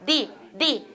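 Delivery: 리 softly, tongue striking the front; tongue-tip tap. Shown as a demonstration. Teacher showing the tap to trainees, soft tapped 리. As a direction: flick the tongue tip forward on 리. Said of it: "혀 끝을 앞에다 대고 딱 쳤다".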